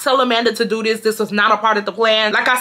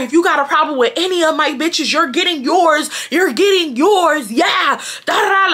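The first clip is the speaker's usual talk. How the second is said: mock screaming threat; 7 semitones higher than usual. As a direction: yell the threat at the crying girl. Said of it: "she's just screaming".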